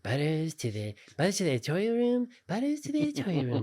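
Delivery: put-on cartoon character voice climbing very high; Butters. Producer paging himself over the studio PA, in high Butters voice. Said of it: "he would talk in voices 'cause".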